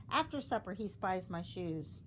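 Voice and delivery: ordinary delivery, nothing marked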